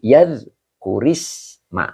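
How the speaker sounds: "yadhkurisma" slowly joined, kasroh linking into isma; slow teaching demo of "yadhkurisma"; slow linked reading. Shown as a demonstration. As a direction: draw "yadhkurisma" out as one word. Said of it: "maka boleh baca".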